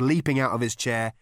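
ordinary delivery, nothing marked

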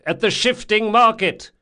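high, loud challenge through a door; shouted. A narrator's reading. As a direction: call out the password question at full voice. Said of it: "he shouted".

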